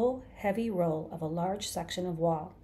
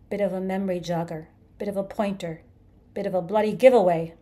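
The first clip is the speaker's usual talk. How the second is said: climbing from loud to a much louder roar; building bellow; 9 dB louder than usual. A narrator's reading. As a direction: pile it on until it explodes. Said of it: "he bellowed".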